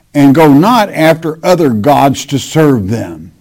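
measured, solemn Bible reading; scripture reading. Acted. Preacher reads Jeremiah to his congregation, solemn scripture reading. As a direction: read the verse out to the congregation.

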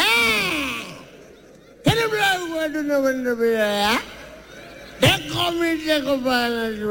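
high, drawn-out old-man impression; mock Tinubu. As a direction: stretch every word like a shaky old man. Tinubu's voice on the phone.

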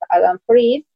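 ordinary talk

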